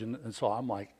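ordinary delivery

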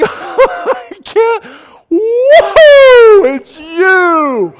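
whooping, high and very loud disbelief; overjoyed amazement. Acted. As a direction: act out an ecstatic, laughing reunion shout. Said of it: "That's what's happening here".

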